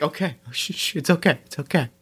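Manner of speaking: soft soothing shush, it's okay; rhythmic shushing. Pretend. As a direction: hush a scared child gently. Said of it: "and then it's like".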